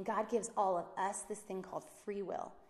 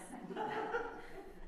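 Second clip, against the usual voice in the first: airy laugh; 4 dB quieter than usual. Real, not acted. Laugh after joke.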